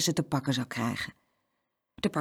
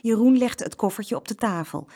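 ordinary delivery

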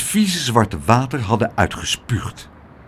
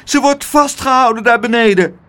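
high, distressed cry; high-pitched, panicky wail; 9 semitones higher, 4 dB louder than usual. A narrator's reading. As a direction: cry out for help in alarm. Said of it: "jammerde Spijterbijt".

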